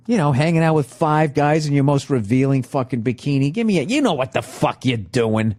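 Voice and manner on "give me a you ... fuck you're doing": ordinary talk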